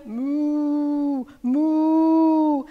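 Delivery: long imitated moos; cow mooing. Storyteller imitating cows in the hut, mooing. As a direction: hold each moo like a cow. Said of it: "What were the cows doing".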